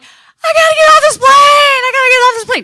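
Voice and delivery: high, loud wailing shout; panicked scream. Retold or imitated. Panicking passenger runs to the back, screaming. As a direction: shriek it in full mock hysteria.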